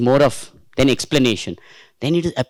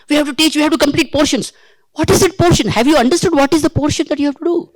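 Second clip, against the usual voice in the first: mock frantic teachers; 9 semitones higher than usual. Mocking hurried teachers.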